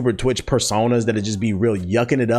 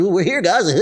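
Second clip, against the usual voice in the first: mock giggly streamer, a bit higher; goofy streamer; 9 semitones higher, 3 dB louder than usual. Mimicking the streamer who ignored him, mock giggles. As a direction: ham up a streamer laughing to his chat. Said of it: "he kept yucking it up and just go".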